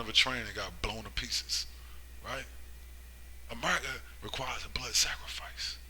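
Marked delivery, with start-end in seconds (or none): 3.5-5.8 whispered warning. Real, not acted. Preacher drops to a warning.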